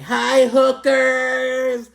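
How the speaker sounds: loud megaphone greeting. Retold or imitated. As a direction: belt it out like through a megaphone. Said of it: "She's being all loud".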